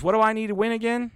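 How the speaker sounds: high, innocent folksy coach; imitating Ted Lasso. Ted Lasso playing dumb to his darts opponent, high folksy voice. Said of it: "And he goes".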